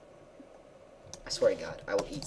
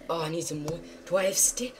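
ordinary talk, nothing marked

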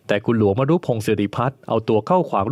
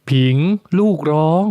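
a raised male lord's call, higher; Luang calling Ping; 6 semitones higher, 4 dB louder than usual. Acted. The lord calling his concubine about their crying baby.

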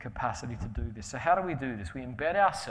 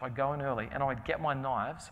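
ordinary talk, nothing marked

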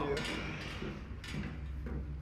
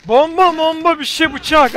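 higher, louder hurried calls; frantic listing; 8 semitones higher, 4 dB louder than usual. Real, not acted. Player frantically naming weapons, excited calls. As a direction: grab the words in a rush.

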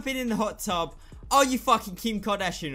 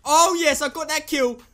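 excited; 5 semitones higher, 8 dB louder than usual. Real, not acted. Getting a kill.

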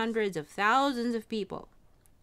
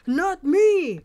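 loud, high protesting yell; scream; 10 semitones higher, 12 dB louder than usual. A narrator's reading. King facing the dogs, screaming out. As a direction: scream in alarm at being seized. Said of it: "screamed the king".

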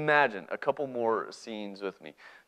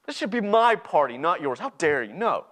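raised, outraged voice with heavy pauses; angry rejection; 6 semitones higher, 6 dB louder than usual. Acted. Older brother rejecting his returned brother, angry. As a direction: push him away in indignation. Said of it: "I'm angry".

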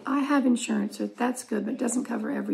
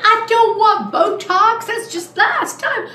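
high, loud drawn-out refusal; indignant high-pitched cry; loud protest; 10 semitones higher, 9 dB louder, slower than usual. Retold or imitated. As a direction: protest loudly, stretching it out. Acted protest at being offered Botox.

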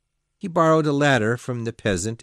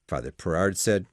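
plain reading voice

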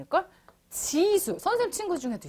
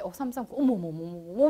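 a hint of flustered self-talk; imitated flustered student; 6 semitones lower, faster than usual. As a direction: lightly play a pupil fumbling mid-problem. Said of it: "막 이런단 말이야".

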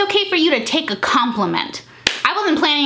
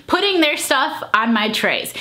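ordinary delivery

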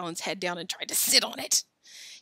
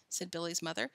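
ordinary delivery

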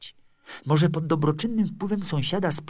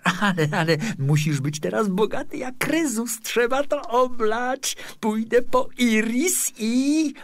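put-on excited young neighbour; 9 semitones higher, 5 dB louder than usual. A narrator's reading. Neighbour plans a toast to his friend's riches, excited. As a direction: gush eagerly, stretching the words.

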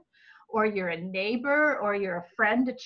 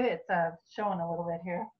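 tired voice; 5 semitones lower, 5 dB quieter, faster than usual. Real, not acted. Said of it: "I've been talking a lot".

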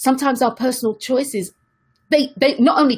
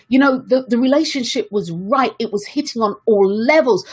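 ordinary delivery

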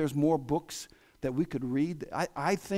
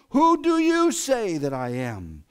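slow, louder, weighty delivery; slow solemn question; 4 semitones higher, 6 dB louder, slower than usual. Acted. Jesus presses his disciples, slow and louder. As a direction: lean on each word.

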